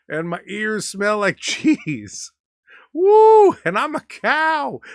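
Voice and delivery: hammed-up giddy cow character; laughing cow. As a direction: play a cow tickled by her own story, drawing out words. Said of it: "That's why she's laughing".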